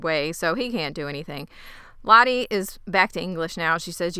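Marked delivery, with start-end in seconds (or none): none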